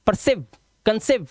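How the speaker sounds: परसिव, कंसिव with a short "siv"; misreading demo, quick "i" in परसिव and कंसिव; wrong short vowel. Shown as a demonstration. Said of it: "ऐसा कुछ नहीं है".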